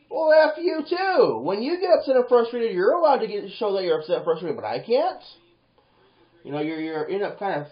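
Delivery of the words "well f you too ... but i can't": high, loud, indignant outburst; yelled comeback from someone being told off; angry retort. Acted. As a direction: fire back in frustration. Acts frustrated angry retort.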